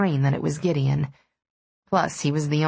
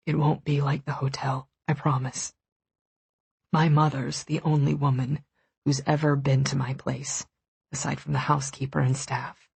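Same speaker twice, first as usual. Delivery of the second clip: murmured; 3 semitones lower than usual. A narrator's reading. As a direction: murmur it close, tenderly. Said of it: "pressed his lips to my forehead and murmured".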